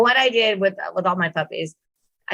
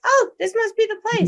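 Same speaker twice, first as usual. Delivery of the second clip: much higher, light puppy voice of discovery; relieved puppy. Retold or imitated. Trainer voices her puppy finding the pad in Brooklyn, relieved. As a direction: squeak it like a pup who found the spot. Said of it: "she's like".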